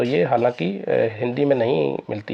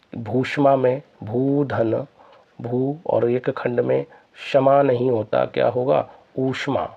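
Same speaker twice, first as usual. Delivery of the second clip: 1 slow explanation; slower than usual. Shown as a demonstration. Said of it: "explaining split".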